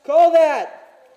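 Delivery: high, loud angry demand; angry shout. Real, not acted. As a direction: yell at the cheater. Angry at enemy not calling hits.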